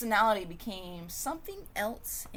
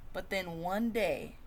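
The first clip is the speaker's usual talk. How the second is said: usual speaking voice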